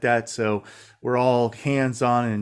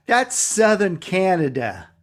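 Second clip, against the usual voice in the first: put-on Brooklyn accent; 8 semitones higher, 4 dB louder, slower than usual. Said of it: "she had that really heavy Brooklyn accent".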